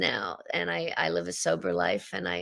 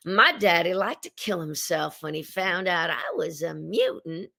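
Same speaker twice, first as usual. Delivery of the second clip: Southern Rogue voice; 4 semitones higher than usual. Acted. Actress auditioning as Rogue in a Southern accent. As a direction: read the Rogue audition lines in a husky Southern accent, climbing in pitch. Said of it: "I just read the first little paragraph".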